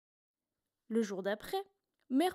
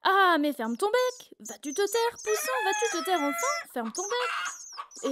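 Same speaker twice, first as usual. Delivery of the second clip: shouting chorus; 8 semitones higher than usual. A narrator's reading. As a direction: shout the chick down together. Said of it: "se mettent à crier".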